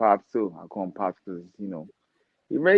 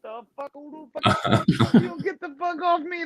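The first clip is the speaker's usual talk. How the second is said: much higher, alarmed yelp; startled reaction. Retold or imitated. Boxer grabbed by a stranger, startled much higher. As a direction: jump back, shocked at the grab. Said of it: "And I'm like".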